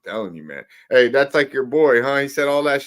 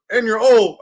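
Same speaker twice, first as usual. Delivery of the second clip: mock troll jab; 6 semitones higher, 7 dB louder than usual. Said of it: "when they say they're old".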